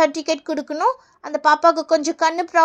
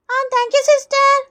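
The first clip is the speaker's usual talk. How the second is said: high, slow schoolkid voice; drawn-out childlike thanks; child pupil; 5 semitones higher, much slower than usual. Acted. Pupil thanking the nurse, slow high child voice.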